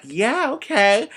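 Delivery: put-on high, drawn-out eager child's yes. A kid eagerly saying yes to the fortune teller. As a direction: gush it out like a thrilled kid.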